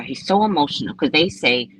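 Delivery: ordinary talk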